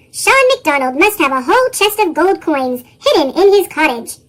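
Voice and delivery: plain reading voice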